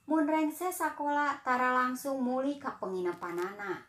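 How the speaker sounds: usual speaking voice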